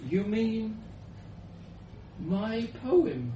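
high, halting, puzzled question; interrupting question. Acted. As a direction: ask it incredulously with a long pause. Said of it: "I interrupted".

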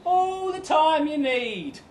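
high and slow, stretched words; slow, high-pitched brave face; drawn-out forced reassurance. Acted. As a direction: repeat it high, dragging out every word. Repeats the brave reply, drawn out.

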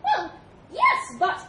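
high, loud mouse stammer; flustered little mouse. Acted. Mouse trying to answer the elephant, high and flustered. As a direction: squeak a hesitant objection.

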